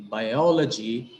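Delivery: biology with a long, accented O in second place; biology slowly, weight on the O; stress on O. Shown as a demonstration. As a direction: stretch the O in biology. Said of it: "it is o".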